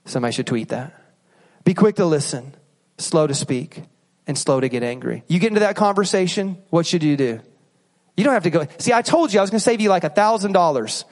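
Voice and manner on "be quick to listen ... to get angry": scripture reading. Shown as a demonstration. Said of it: "verse repeated".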